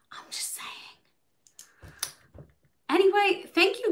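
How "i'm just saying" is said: whispered aside, no voice at all. Real, not acted. Vlogger whispers a cheeky aside to viewers, whispering. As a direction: drop to a breathy whisper.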